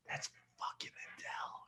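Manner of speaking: hushed, voiceless whisper of a friend; whispered tip-off. Retold or imitated. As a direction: lean in and whisper it urgently. Friend tipping him off quietly.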